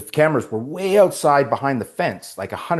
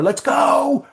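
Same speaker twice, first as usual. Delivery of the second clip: very high, drawn-out holler; imitated distant shout; 4 dB louder than usual. Crew outside calling to actor indoors, high imitated shout.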